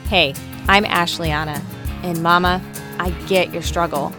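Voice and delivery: normal talking voice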